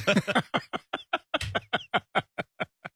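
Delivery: long breathy, voiceless laughing fit. Real, not acted. Host reacting to mom's brutal line, long laughing fit. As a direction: crack up helplessly, mostly on breath. Cracking up at harsh mom quote.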